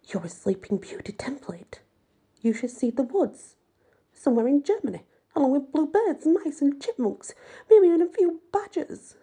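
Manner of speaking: flustered scolding. Acted. Madame's cheeks burn red.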